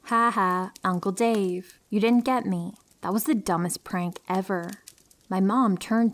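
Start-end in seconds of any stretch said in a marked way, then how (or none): none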